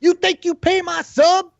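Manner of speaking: mocking whine that jumps high at the end; mocking a toxic player. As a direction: mimic a defensive player snapping back at advice. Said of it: "then they're like".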